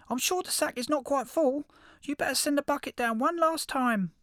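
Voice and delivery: very high, loud little critter voice; high squeaky hedgehog. Acted. Hedgehog voice held.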